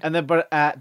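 normal talking voice

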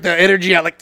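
ordinary talk

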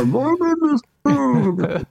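slow, high, muffled masked villain; muffled Bane voice. Retold or imitated. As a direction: drag it out behind a covered mouth. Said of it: "he sounds a little more muffled".

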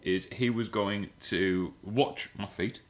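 usual speaking voice

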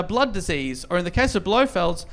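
ordinary delivery

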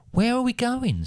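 put-on very high little girl question; young girl's voice. A narrator's reading. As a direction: pitch it way up as the girl. Narrator voices the girl Spoon asking.

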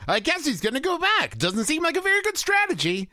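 high, overly chirpy read of sarcasm; upbeat line-read missing the sarcasm; bad acting. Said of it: "But he delivered it like".

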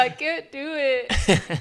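normal talking voice

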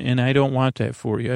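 usual speaking voice

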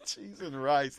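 normal talking voice

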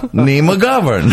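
ordinary talk, nothing marked

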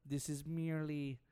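slow, sinister mad scientist; villainous scientist, drawn out; evil scientist voice. Pretend. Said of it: "That's my evil scientist voice".

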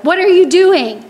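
loud, high outburst, mock-angry; angry pastor. Angry pastor in the sleeper's face, mock-angry shout. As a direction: demand it sharply, high and loud. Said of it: "this really angry pastor right in my face".

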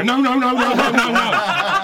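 high, loud drawn-out noes; loud chorus of pleading noes; lads protesting. Retold or imitated. The lads beg him not to, loud high noes. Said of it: "All the other lads go".

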